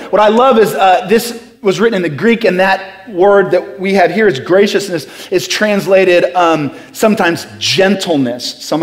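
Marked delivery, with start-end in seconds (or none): none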